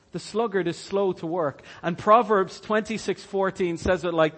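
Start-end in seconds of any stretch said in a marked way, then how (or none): none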